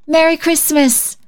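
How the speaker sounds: put-on high happy child's cry; joyful cry. A narrator's reading. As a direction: call out with glee. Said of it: "she cried".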